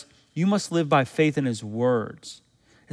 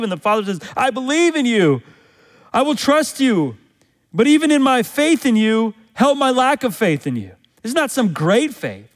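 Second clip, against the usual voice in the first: fervent preaching; 8 semitones higher, 3 dB louder than usual. Acted. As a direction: preach it high and driving. Preaching climax, retelling Mark 9.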